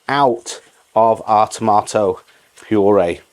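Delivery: ordinary talk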